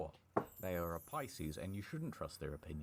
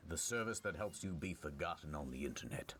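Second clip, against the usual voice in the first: ordinary talk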